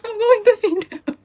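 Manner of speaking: high, loud, crying with joy; overjoyed tearful cry; happy crying. Real, not acted. Crying with joy after tickets.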